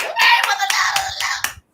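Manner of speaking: high, slow shout of a fan; calling out. Retold or imitated. Fans at the concert calling her name, shouting. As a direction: holler at her across the crowd.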